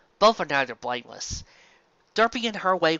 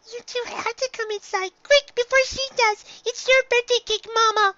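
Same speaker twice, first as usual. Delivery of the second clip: eager little filly, pitched much higher; 4 dB louder than usual. Acted. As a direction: chatter like an excited young filly. Same filly, now urging.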